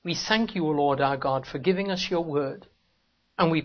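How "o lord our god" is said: ordinary delivery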